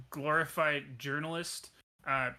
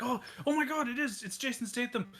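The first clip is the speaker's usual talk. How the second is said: higher, gushing starstruck co-pilot; starstruck fan; 6 semitones higher than usual. Acted. Co-pilot meeting a movie star, gushing and higher. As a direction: gush like a fan meeting a hero.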